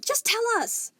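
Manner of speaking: high, loud angry yell. Acted. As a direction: yell at him through the door. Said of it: "Amethyst yelled".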